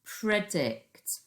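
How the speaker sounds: ordinary talk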